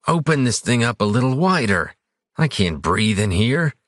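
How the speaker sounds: a frustrated man starting to panic; rising panic. A narrator's reading. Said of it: "a note of rising panic and frustration in his voice".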